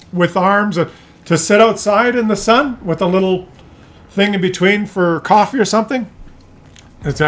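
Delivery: ordinary delivery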